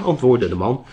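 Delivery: ordinary delivery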